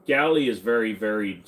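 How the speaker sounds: usual speaking voice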